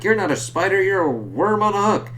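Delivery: high, sneering villain voice; mocking villain taunt. Reviewer voicing Hobgoblin taunting Spider-Man, high and sneering. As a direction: taunt him like a gloating goblin. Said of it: "being like".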